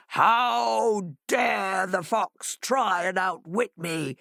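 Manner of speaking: slow, indignant sea-monster voice; Leviathan bellowing. A narrator's reading. Sea monster offended by the fox, slow indignant bellow. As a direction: draw out the outrage like a proud sea king. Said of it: "he bellowed".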